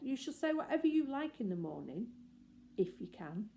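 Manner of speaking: ordinary delivery, nothing marked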